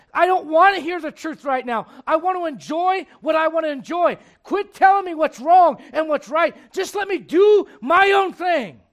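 loud, high-pitched protest, slowing at the end; defiant shouting. Acted. Sinner refusing to hear the truth, defiant shouting. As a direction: shout down whoever is correcting you. Acts out person rejecting the truth.

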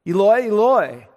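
high, loud sneering "Eloi, Eloi"; mocking taunt. Mocker at the cross imitating Jesus, sneering high and loud. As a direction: sneer "Eloi, Eloi" like a jeering bystander. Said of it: "In complete mockery".

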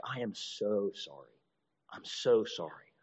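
mild apology. Retold or imitated. Offender apologizing softly after smacking someone, slow and hushed. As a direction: murmur a meek apology, taking your time. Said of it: "someone smacks you in the face and like".

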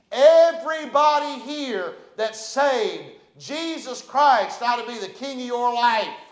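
high, loud, slow call to the church; preaching loud. Real, not acted. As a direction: call it out to the whole congregation. Preacher calls out to congregation.

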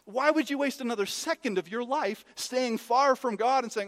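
high, loud challenge from the pulpit; impassioned preaching. Acted. Preacher challenging those who stay far from God, impassioned. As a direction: press the question hard on the congregation.

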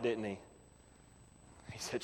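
ordinary talk

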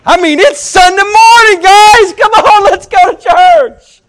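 high, shouted, over-the-top enthusiasm; rally everyone like a thrilled fan; excited. Acted. Preacher acts rousing folks for Sunday church, wildly excited. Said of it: "Ever get excited about going to church?".

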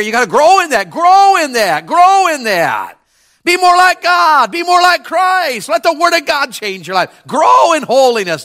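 high, loud, slow exhortation; full preaching climax. Real, not acted. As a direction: drive it home to the church.

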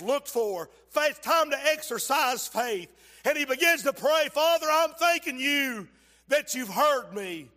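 loud, high, preaching build; full-force preaching. Acted. Preacher telling the congregation of Jesus' prayer, loud preaching. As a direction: preach it to the back row.

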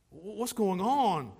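ordinary talk, nothing marked

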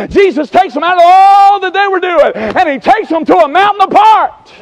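high, driving revival-preacher chant; full-force preaching. Real, not acted. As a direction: pile up each phrase in relentless, high-pitched cadence.

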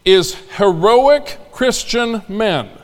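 ordinary delivery

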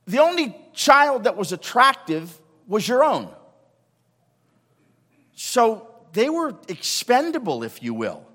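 high, loud preacher's build; full-force preaching. Real, not acted. Pastor pressing the congregation, loud and high. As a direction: drive the point home to the congregation.

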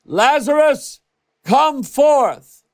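high, loud call into the tomb; loud cry. A narrator's reading. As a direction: command the dead man to rise. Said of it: "he cried with a loud voice".

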